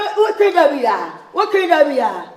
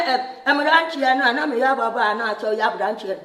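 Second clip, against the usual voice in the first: fading; 6 semitones lower, 6 dB quieter than usual. Real, not acted. Voice fades away.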